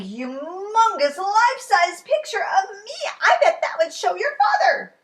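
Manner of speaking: high, loud, gleeful with a laugh; giddy with the big plan; excited Ted. A narrator's reading. Ted thrilled about painting a huge picture for father, gleeful.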